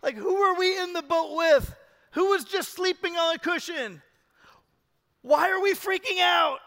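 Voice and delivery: loud, high-pitched disbelief; stunned disciples. Retold or imitated. Disciples reeling after the storm is stilled, loud stunned disbelief. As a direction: blurt it out like stunned men in a boat. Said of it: "They're still stunned by what happened".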